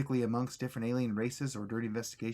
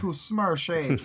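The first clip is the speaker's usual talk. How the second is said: slow, loud mock Russian accent; 4 semitones higher, 5 dB louder, slower than usual. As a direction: try a heavy Russian accent. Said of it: "Trying to figure out how to do a Russian accent".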